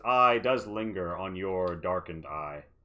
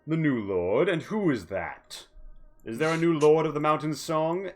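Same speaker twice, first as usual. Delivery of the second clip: surprised; 8 semitones higher, 4 dB louder than usual. Acted. Duraza surprised by news.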